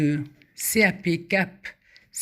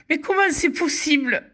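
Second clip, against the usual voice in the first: laughing; 9 semitones higher than usual. Real, not acted. Amazed at winning again.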